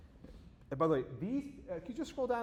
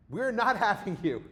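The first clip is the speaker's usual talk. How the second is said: mock protester shout; 8 semitones higher, 8 dB louder, slower than usual. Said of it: "And they said".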